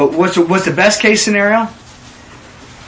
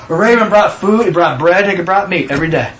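ordinary talk, nothing marked

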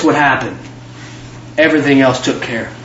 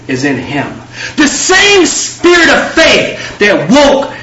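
rising, half-shouted preaching, slowed and higher, with laughs; full-force preaching; 5 semitones higher than usual. Acted. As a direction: build each "the same spirit" into a triumphant cry. Preaching crescendo on same spirit.

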